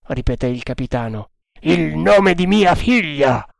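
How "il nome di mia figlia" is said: slow, higher-pitched cry; agitated exclamation. A narrator's reading. Captain astounded to hear his daughter's name, slow exclamation. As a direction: draw it out in mounting agitation.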